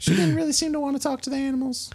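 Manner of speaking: high, folksy farmer voice; farm parent. Acted. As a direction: gush about the neighbour. Farmer voice in role-play.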